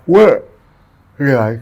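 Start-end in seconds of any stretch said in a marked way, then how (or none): 0.1-0.5 sharp, loud high "What?"; startled what. Retold or imitated. Retelling the guard's summons, a loud shocked what.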